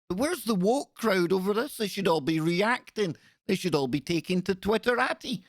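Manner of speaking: mock indignation. Mocking the woke crowd's silence, sarcastic high voice. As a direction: taunt them with fake outrage.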